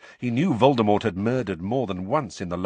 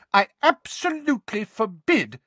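angry; 6 dB louder than usual. Acted. Mrs Weasley shouting.

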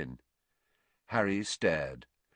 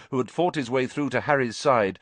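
ordinary delivery, nothing marked

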